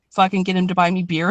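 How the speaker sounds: normal talking voice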